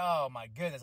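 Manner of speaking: usual speaking voice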